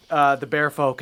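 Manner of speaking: ordinary talk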